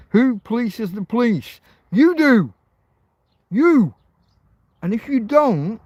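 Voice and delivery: a fired-up shout at the audience; ranting shout. Real, not acted. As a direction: hammer home who is responsible. Ranting at viewers.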